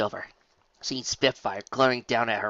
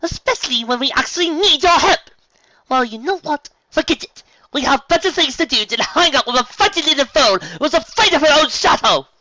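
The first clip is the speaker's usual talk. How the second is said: high, loud contemptuous tirade; berating Rarity; 14 dB louder than usual. Acted. Rarity berating Fluttershy, high loud scornful voice. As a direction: pile on the insults.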